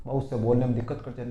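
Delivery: usual speaking voice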